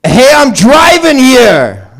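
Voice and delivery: high, slow, loud New York "Hey" yell; New Yorker impression. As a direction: belt it like a cabbie leaning out the window. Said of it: "This is a New Yorker driving".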